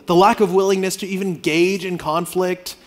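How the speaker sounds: usual speaking voice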